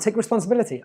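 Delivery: normal talking voice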